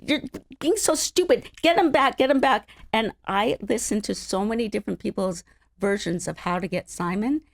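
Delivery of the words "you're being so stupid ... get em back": high, loud, nagging heckle; mock heckling fans. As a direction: yell advice like an online heckler. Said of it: "message boards going".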